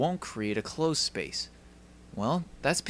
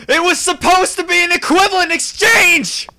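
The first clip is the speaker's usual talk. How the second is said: screamed, much higher and louder; anguished scream; 14 dB louder than usual. Acted. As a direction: scream it out in despair. Climax of despair over transmutation.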